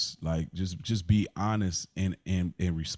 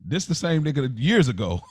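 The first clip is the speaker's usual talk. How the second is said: mock realization; 4 semitones higher than usual. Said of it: "I say".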